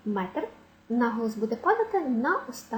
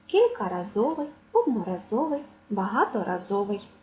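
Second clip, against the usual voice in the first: each word stressed on ЗО: Кількаразовий, одноразовий, багаторазовий; penultimate stress; 2 semitones lower than usual. Shown as a demonstration. Teacher listing words with root разовий, penultimate stress. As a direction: list them, landing on ЗО in -разовий. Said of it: "будуть мати наголос на передостанньому складі".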